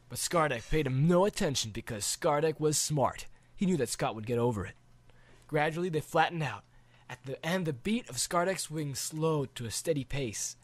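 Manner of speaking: usual speaking voice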